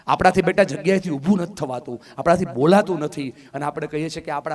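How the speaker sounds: usual speaking voice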